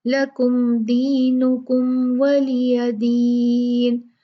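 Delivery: very slow chanted tajweed recitation; Quranic recitation. Shown as a demonstration. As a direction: recite it, drawing out the madd. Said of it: "दोनों मीम को वाजे पढ़ना है". (धीमी तजवीद वाली तिलावत)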